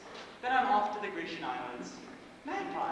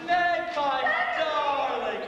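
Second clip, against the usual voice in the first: loud, theatrical hello from the doorway; booming greeting; 9 semitones higher, 8 dB louder, much slower than usual. Acted. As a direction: sweep in calling her name. Flamboyant guest bursts in greeting.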